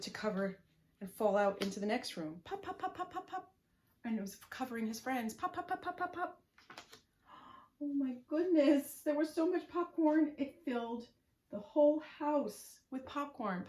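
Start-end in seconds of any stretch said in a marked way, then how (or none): 2.5-3.5 popping sounds. Acted. Popcorn sound effects. 5.3-6.4 popping sounds. Acted. Popcorn sound effects. 7.8-13.7 excited. A narrator's reading. Excited storytelling climax.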